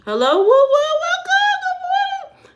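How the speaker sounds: high, loud, whooping welcome; a mock over-the-top hello; hyped greeting. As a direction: shout it like a game-show host. Hyped greeting to new viewer.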